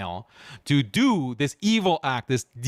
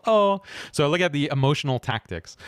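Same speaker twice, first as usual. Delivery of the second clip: normal talking voice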